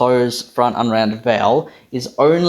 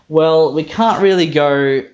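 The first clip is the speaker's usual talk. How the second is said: ordinary talk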